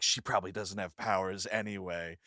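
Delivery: low, soft mock-catty aside; imitating a snide remark between friends; shitty aside. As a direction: drop it under your breath, mildly snide. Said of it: "shittily one to the other".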